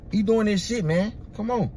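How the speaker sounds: ordinary talk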